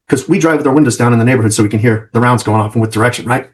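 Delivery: ordinary talk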